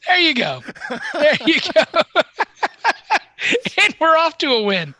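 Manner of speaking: amused, laugh breaking around the words. Real, not acted. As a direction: crack up while cheering them on. Cracking up over fumbled word.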